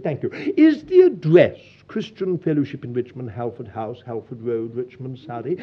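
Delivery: posh caller reading out an address; lightly put-on posh phone voice; terribly posh. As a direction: imitate a posh English caller, dropping low. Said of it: "a terribly posh voice said".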